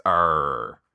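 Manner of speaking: growly pirate captain arr. Retold or imitated. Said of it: "making the pirate voice".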